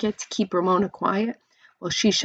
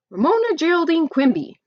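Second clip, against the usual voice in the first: exasperated full-name scold; 9 semitones higher, 5 dB louder than usual. A narrator's reading. Exasperated big sister scolding.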